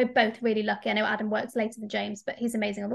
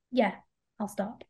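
normal talking voice

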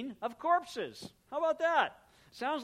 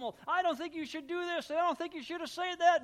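higher, louder, hammed-up scolding; mock accuser; 10 semitones higher, 6 dB louder than usual. Preacher playing someone accusing a listener, higher hammed-up scolding. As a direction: wag a finger at them.

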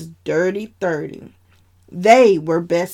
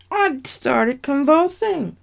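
ordinary delivery, nothing marked

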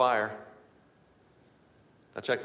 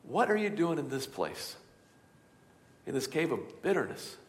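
God's gentle question; 3 semitones higher, 7 dB quieter than usual. Acted. God calling softly to those in bitterness. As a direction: call gently into the cave. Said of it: "God brought you here to say".

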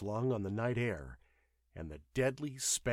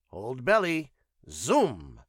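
louder, punched alien pilot's warning; urgent command; 3 semitones higher, 8 dB louder, slower than usual. A narrator's reading. Pilot warns nurse before takeoff, urgent. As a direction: bark two short bursts, the last one falling.

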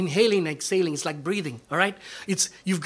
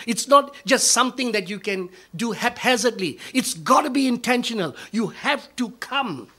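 louder, higher preaching push on every command; preaching at full force; 7 semitones higher, 6 dB louder than usual. Acted. Pastor urging his congregation to make time for God, full-force preaching. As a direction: drive each command home to the pews.

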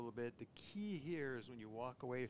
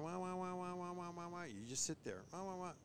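higher, soft whiny wah wah; imitating puppy whining; 7 semitones higher, 3 dB quieter than usual. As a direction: mimic a whimpering puppy. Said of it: "puppy's whining".

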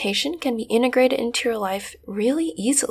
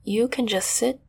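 calm, unhurried meditation guide voice; guided meditation. Acted. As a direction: soothe them gently into the scene.

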